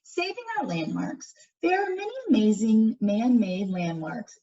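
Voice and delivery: put-on clear, lively read-aloud for young listeners; reading to children. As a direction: read it out to the class like a picture book. Reads picture book to class.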